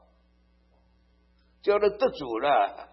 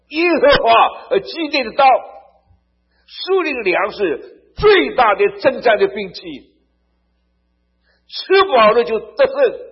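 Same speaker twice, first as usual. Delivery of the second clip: much higher and louder, proclaiming; fiery preaching; 9 semitones higher, 12 dB louder than usual. Real, not acted. Preacher rousing the congregation, fiery and loud. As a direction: thunder it from the pulpit.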